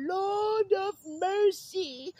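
high, slow falsetto sigh; mock exclamation. Marveling at his own looks in a slow falsetto.

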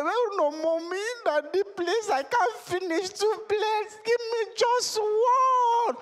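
put-on high child begging, stretched out slowly at the end; pleading child voice. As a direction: beg mommy for mercy, dragging the last words out slowly. Junior begs his mother.

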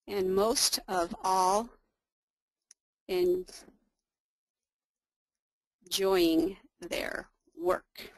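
very slow, halting word groups; drawn-out phrases with long gaps between; slow typing dictation. Real, not acted. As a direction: say it piece by piece as you type. Reading aloud while typing dialogue.

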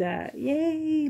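normal talking voice

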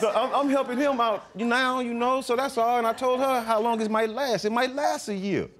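talking through laughter; trying not to laugh. Real, not acted. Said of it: "you can't keep a straight face".

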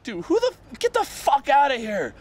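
high hammed-up telling-off; retold angry get out; mock angry dismissal. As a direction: throw him out like a fed-up uncle. Uncle chasing visitor off, retold.

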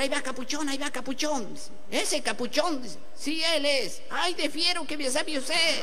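softly voiced, lightly put-on lady admirers; half-hushed gossip between two onlookers. Two admirers gossip on spotting comedian.

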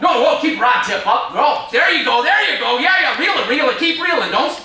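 high, loud, urgent cheering-on; real thrilled fishing excitement; excited coaching. Real, not acted. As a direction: shout urgent instructions to someone landing a fish. Coaching someone reeling in a fish.

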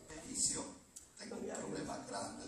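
hushed, whispered mother's confidence; barely audible, urgent aside to the pastor; confiding whisper. Retold or imitated. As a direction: whisper it like a troubling secret. Mother confides a grave problem.

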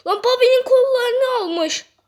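high, loud shout of disbelief; startled outcry. Real, not acted. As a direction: blurt it out loudly, pitch shooting up. Startled outburst during gameplay. (şaşkınlıkla bağırarak)

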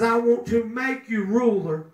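ordinary talk, nothing marked

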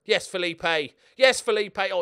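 cheering the striker's goal; celebrating yell. Real, not acted. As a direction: shout it out in triumph.

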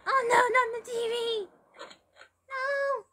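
high, loud dismayed cry. Real, not acted. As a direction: wail as it gets destroyed. Dismayed at game object destroyed.

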